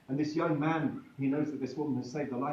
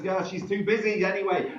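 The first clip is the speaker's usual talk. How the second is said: woman's quip; 6 semitones higher, 7 dB louder than usual. Acted. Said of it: "another one says".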